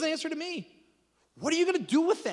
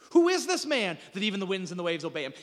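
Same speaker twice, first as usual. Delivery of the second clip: usual speaking voice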